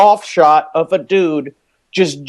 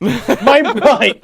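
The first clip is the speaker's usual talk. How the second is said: mock cry; 4 semitones higher than usual. Echoing friend's mock scream.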